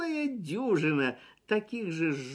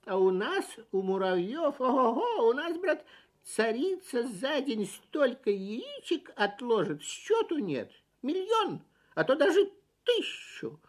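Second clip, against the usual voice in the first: boastful ant voice; 6 semitones higher than usual. A narrator's reading. Ant boasting about his queen.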